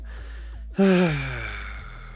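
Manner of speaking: long, drawn-out sigh; weary sigh. Acted. Boy sighing again on the trek, long weary sigh. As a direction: let out an exaggerated sigh. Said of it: "Connor sighed again".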